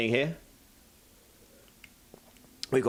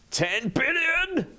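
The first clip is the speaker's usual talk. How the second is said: Dragon Ball scream; 14 semitones higher than usual. Pretend. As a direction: scream it like an anime hero. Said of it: "for Vegeta".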